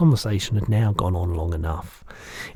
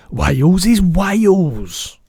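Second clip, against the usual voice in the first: big, slow, booming giant voice; loud lumbering giant, higher and slow; the giant's voice; 11 semitones higher, 10 dB louder, much slower than usual. Acted. Giant correcting the girl, big booming voice.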